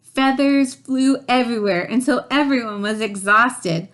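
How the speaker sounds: ordinary delivery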